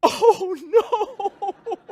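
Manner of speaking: a high, loud cry of dismay; imitating his son's loud, high-pitched wail; dismayed teen wail. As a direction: wail it like a kid seeing a ruined jacket. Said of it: "he went".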